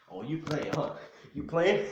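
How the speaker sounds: loud, high scolding outburst; threatening father. Acted. Father reaching for the belt at his son, loud threat. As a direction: shout it as an incredulous warning.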